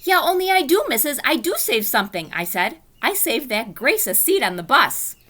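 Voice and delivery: high, loud eager burst; real excited. Acted. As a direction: blurt it out thrilled. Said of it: "I jumped up real excited".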